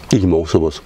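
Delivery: talking while chewing; words round a bite of food; mouth full. Real, not acted. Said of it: "mit vollem Mund redet man nicht". (mit vollem Mund)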